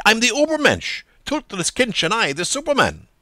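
Buck Mulligan's voice. A narrator's reading. Mulligan boasting in story.

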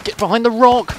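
high, loud battlefield yell; shouted order. Pretend. Player sending troops to cover in the game, shouted order. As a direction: yell it as an urgent squad command.

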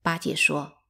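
ordinary delivery, nothing marked

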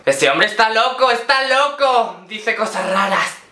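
high, slow mock yell; mock shouting. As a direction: shout like a freaked-out stranger. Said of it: "un hombre empezó a gritarme".

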